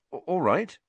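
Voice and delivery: uneasy, faltering agreement; awkward. A narrator's reading. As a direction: stumble on the first sound, unsure.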